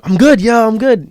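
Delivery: high, loud insistence; put-on okay act. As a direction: brush off concern with over-eager assurance. Acts out insisting he is fine.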